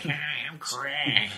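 high, slow, nasal whiny voice. Retold or imitated. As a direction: pinch it through the nose, slowly. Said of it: "I am the king of the nasally voice".

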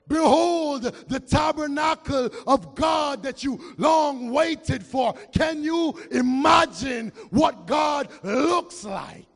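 loud, slow shouted scripture; preaching at full force. Real, not acted. Preacher reading Revelation to the church, full-force shouting. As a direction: thunder the verse out.

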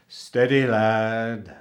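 loud, hearty, reassuring cook; steady the boy with a smile; kindly cook. A narrator's reading. Cook calms the panicking cabin boy, kindly. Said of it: "said the cook with a kindly smile".

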